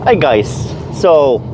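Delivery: ordinary delivery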